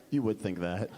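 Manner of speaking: very low, mysterious build-up; suspenseful reveal. Retold or imitated. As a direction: sink deep to tease a twist. Songwriter builds up the twist.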